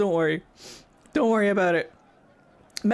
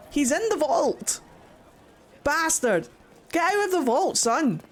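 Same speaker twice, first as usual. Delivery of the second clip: high-pitched indignant outburst; exasperated scolding; 7 semitones higher than usual. Real, not acted. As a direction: order the culprit out in exasperation. Scolds the character hiding in vault.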